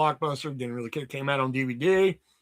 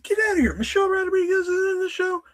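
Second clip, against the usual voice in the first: stunned disbelief; 13 semitones higher than usual. Retold or imitated. Retold shock at cover.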